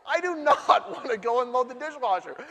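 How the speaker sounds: high, loud no with a laugh; refuse, half joking; playful refusal. Retold or imitated. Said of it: "I'm very honest with her".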